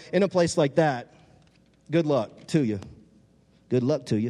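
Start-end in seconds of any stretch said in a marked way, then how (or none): 1.9-4.3 lightly put-on swamp accent; a hint of Swamp People's Louisiana bayou accent. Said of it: "If you watch Swamp People".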